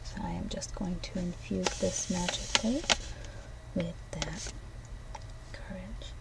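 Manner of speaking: fading into a whisper. Acted. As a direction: breathe the spell out softly. Magical infusing ritual.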